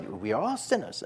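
imitating an African translator's accent; lightly imitated African-accented English. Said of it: "he does it with the African accent".

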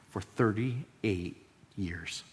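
slow emphasis. Real, not acted. Emphasising years of illness.

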